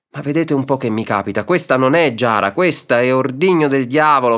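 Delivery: usual speaking voice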